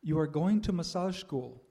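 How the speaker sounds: Swami's command. Retold or imitated. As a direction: issue it as a measured, weighty order. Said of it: "like a command".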